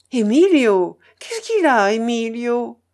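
loud shout from behind a door; alarmed cry. A narrator's reading. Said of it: "cria une voix derrière la porte". (cri fort et inquiet)

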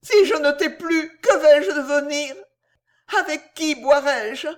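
voix aiguë qui pleure; sanglotant. A narrator's reading. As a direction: sanglote de désespoir. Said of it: "en versant des larmes sincères". (pleure sincèrement, voix haute)

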